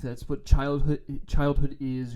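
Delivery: ordinary talk, nothing marked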